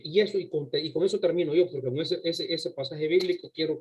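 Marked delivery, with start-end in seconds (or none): none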